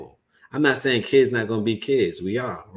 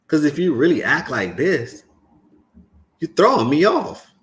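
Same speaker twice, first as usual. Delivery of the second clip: high, loud burst of disbelief; exasperated outburst; 5 semitones higher, 4 dB louder than usual. Real, not acted. Son venting about his mother's behaviour, baffled and loud. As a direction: throw it out, baffled and fed up.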